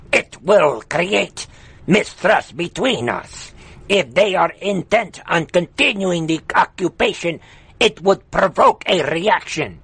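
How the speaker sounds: lightly voiced Afghan accent, mocking. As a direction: keep the put-on Afghan accent going. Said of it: "I should do the right accent".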